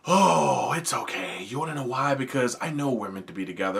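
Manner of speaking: quiet creepy self-assurance; creepy stalker. Retold or imitated. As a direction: murmur it like a calm obsessed creep. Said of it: "he's just like".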